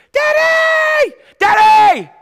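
high frightened shout for daddy; scared child calling. Retold or imitated. Child in the dark shouting for daddy, high and frightened. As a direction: cry out like a scared little kid. Kids crying out at night.

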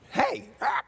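squawking parrot greeting; sudden mimicked bird screech. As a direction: call out like a parrot, then squawk. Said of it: "he walks near and hears this".

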